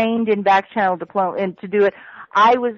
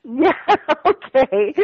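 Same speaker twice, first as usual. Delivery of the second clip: laughing; 4 semitones higher, slower than usual. Real, not acted. Laughs after crude joke.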